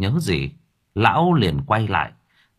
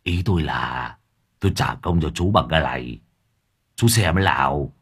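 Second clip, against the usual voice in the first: lightly voiced Lâm Thọt; 7 semitones lower than usual. A narrator's reading. Lâm Thọt offering the knife as payment, meek.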